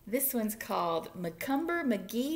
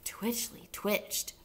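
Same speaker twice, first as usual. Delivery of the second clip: ordinary delivery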